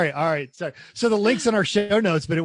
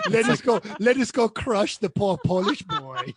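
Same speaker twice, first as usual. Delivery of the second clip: put-on hammy German-accented villain car; bad German accent; 2 semitones higher than usual. AMG car urging a race, in a bad German accent. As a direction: goad slowly in a mock German accent. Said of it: "I'm doing a bad German accent".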